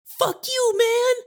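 very high, tiny squeaky bug voice; a squashed bug's last high squeak; tiny squeaking lice. Acted. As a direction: squeak it out like a dying insect. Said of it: "I hear its parting squeak".

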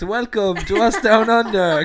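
loud, high, slow show intro; big hammed-up podcast welcome; mock host announcement. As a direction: announce your takeover like a proud host. Mock takeover as new host.

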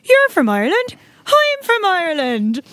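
high-pitched, eager fake Irish accent; put-on Irish accent. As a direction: badly fake being Irish, pitched right up. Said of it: "literally just spoken to him in my Australian accent".

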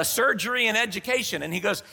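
ordinary talk

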